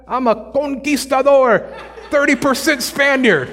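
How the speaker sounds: high, hammed-up proud boast through a laugh; mock conquistador boast. Preacher mocking a heritage boast to the devil, hammed-up and high. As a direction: puff up like a conquistador, laughing at the idea. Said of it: "I can't be like".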